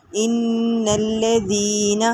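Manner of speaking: slow recitation, nasal hum held on the doubled noon. Shown as a demonstration. Teacher demonstrating ghunna to students, slow recitation. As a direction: hold the ghunna as you recite.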